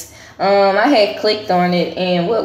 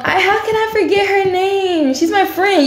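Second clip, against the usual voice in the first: real catch of tears in a much higher voice; fighting tears over a lost friend; tearful and upset; 12 semitones higher than usual. Real, not acted. Upset she can't recall her college friend's name, tearful and much higher.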